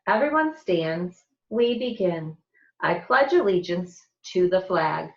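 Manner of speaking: usual speaking voice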